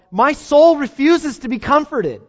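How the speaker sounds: high, loud and slow lament; preacher voicing a despairing psalmist, slow and loud; anguished psalm quote. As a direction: stretch out the despair.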